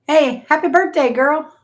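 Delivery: bright shouted happy birthday; excited greeting. Real, not acted. Greeting someone in live chat.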